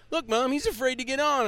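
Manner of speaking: put-on high-pitched child tattling to Mom; little kid. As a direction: imitate a kid calling out to his mother. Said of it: "there's this little kid going".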